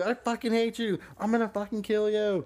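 imitating girlfriend.

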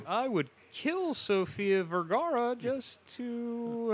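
high, loud, drawn-out declaration; hammed-up shifty confession; shifty mock plotting. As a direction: stretch it out like a guilty schemer. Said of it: "he says with shifty eyes".

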